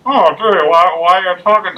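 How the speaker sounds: mock side-of-mouth voice, high and loud; [name]. Friend's bad impression of Corey, high side-mouth voice. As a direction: imitate someone talking from the corner of the mouth. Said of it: "She would talk out of the corner of her mouth".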